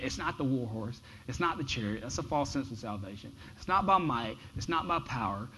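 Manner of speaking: usual speaking voice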